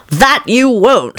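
slow, firm and defiant. A narrator's reading. Alice defying the Rabbit, slow and firm. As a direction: draw it out with stubborn resolve. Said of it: "thought Alice".

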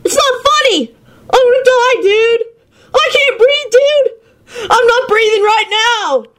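high sobbing panic; panicked crying. Acted. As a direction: wail it like you can't breathe. Stoner panicking, thinks he'll die.

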